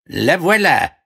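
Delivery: high, triumphant frog call; talking frog. Acted. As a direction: announce it as a high-voiced frog. Frog returns with the ball. (voix aiguë de grenouille)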